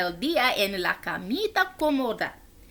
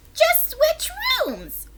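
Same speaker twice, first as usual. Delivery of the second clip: high, slow whispered bird voice. Acted.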